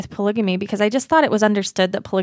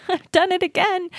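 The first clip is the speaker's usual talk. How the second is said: much higher, laughing, mock dismay; high laughing repeat; 10 semitones higher than usual. Repeating her own slip to the host, high mock-dismayed laugh. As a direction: squeal it out through laughter at yourself.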